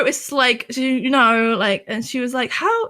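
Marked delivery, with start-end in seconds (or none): 2.5-2.9 high, questioning "How"; suspicious mom. Retold or imitated. Mom grilling her daughter about money, high and suspicious. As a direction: pitch up like a worried mother.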